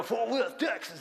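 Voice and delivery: imitated thick Southern twang; Southern accent. Shown as a demonstration. Pastor shows how husband talked, Southern accent. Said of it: "That's how he talked".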